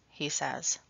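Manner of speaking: plain reading voice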